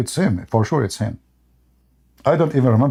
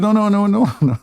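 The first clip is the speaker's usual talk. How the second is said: high, laughing string of no's; 10 semitones higher than usual. Retold or imitated. Retelling how he kept refusing the actor, laughing no's.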